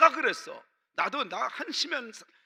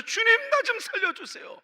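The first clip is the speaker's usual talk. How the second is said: much higher, pleading cry to be saved; desperate cry for help; 13 semitones higher, 4 dB louder than usual. Retold or imitated. Drowning Peter begging the Lord to save him, desperate high plea.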